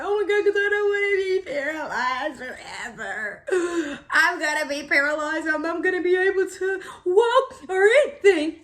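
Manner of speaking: high, loud wailing panic; mock panic. Patient scared of paralysis from epidural, hammed-up panicked wail.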